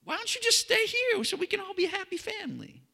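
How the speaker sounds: very high, squeaky acted plea; mock pleading. Disciple begs Jesus to stay with them, mock pleading. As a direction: beg in a tiny high voice, hammed-up.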